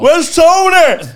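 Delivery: slow, high, loud imitated fan asking around; mock fan shout. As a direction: holler it like an eager fan looking for someone.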